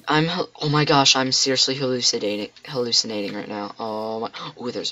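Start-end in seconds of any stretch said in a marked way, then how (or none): none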